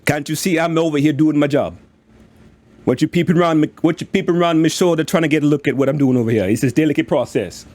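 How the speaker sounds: a light Jamaican-ish lilt, annoyed and quick; busy dwarf snapping at a snoop, Jamaican-ish accent; unique dwarf accent. Acted. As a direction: scold the onlooker in a lilting Jamaican-like dwarf accent. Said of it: "He just has a unique accent for dwarves".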